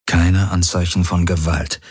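usual speaking voice

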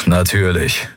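coolly sceptical, dry; cold smile. A narrator's reading. Admiral coldly doubting the empress, dry and cool. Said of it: "Telios lächelte kalt".

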